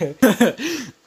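high, loud giggle fit; burst of laughter. Real, not acted. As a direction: crack up laughing. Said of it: "I can't, like, keep a straight face".

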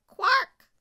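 high, blaring crow caw; piercing bird cry; loud crow squawk. A narrator's reading. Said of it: "perched on a tree with a loud".

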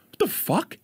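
high disbelieving what the fuck; mock shock. Friend reacting to the news with mock shock. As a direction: act floored by it. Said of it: "you're like".

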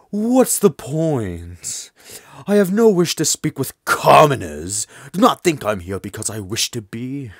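slow, haughty regal delivery; deep regal voice. Acted. Haughty transfer student scorns commoners, regal voice. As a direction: speak like royalty above commoners. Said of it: "I'm just gonna go with a deep regal voice".

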